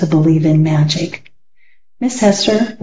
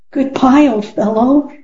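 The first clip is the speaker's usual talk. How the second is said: called out sadly; 5 semitones higher than usual. Acted. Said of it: "he called out sadly".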